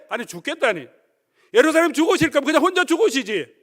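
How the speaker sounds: high, loud, rising angry tirade; furious Peter. Acted. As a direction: rant at Jesus as a betrayed disciple. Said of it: "열받았습니다". (배신감에 격분해 높고 크게 따지는 베드로)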